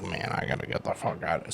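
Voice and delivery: hushed, breathy, voiceless thought; whispered inner thought. Retold or imitated. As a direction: whisper it like panic in your own head. Inner thought while overwhelmed.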